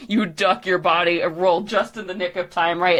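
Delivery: ordinary delivery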